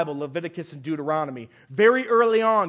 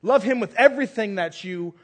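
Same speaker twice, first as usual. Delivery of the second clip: loud, impassioned plea to love God; fervent preaching; 2 semitones higher, 5 dB louder than usual. Acted. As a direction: press it on the people with full force. Preacher urging the congregation.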